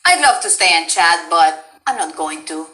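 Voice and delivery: low, dismissive game-character line; Sombra. Acted. Voice line for a subscriber, low and dismissive. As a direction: drop the pitch, coolly brushing them off. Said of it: "gets a Sombra voice line".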